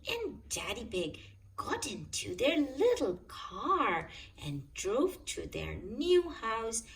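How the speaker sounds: ordinary delivery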